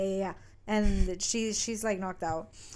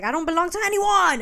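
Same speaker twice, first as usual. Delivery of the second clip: raised, loud declaration; mock defiance; 7 semitones higher, 6 dB louder than usual. Woman teasing her possessive lover, mock defiance. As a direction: toss it out in hammed-up defiance. Woman defies boyfriend, acted.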